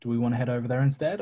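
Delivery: usual speaking voice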